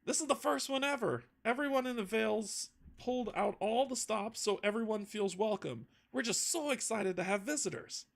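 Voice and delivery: put-on high, fast, eager young local man; a bubbly islander who can't wait to welcome guests; eager young Theo. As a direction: gush with excitement at the visitors. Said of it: "leans forward eagerly".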